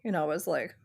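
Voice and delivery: normal talking voice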